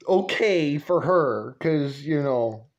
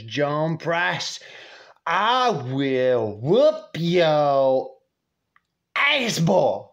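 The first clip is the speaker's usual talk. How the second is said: hammed-up menace with swooping emphasis; threatening grandma; much slower than usual. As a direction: imitate an irate mother, stretching every word. Grandma grabs and threatens his dad.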